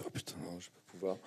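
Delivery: a quiet, dropped-off mutter to himself; panicked inner voice. Retold or imitated. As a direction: retell the dread under your breath. Inner panic at having lines. (murmure paniqué à soi-même)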